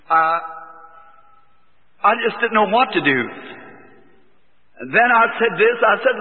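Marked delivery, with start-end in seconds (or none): none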